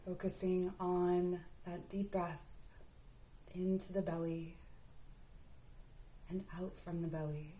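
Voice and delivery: unhurried, even-toned meditation guide; guided meditation. Acted. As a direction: guide the listener gently inward, leaving space to breathe.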